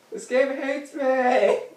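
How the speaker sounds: high, loud protest; loud whiny complaint. Real, not acted. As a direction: wail at the game that hates you. Game setback, complaining.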